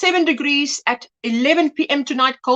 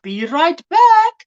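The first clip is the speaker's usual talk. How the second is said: higher, louder and slow, stretching out BACK; drawn-out call; 5 semitones higher, 5 dB louder, slower than usual. Acted. To the viewers before stepping away, a slow drawn-out call. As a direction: call it out long as you leave the viewers.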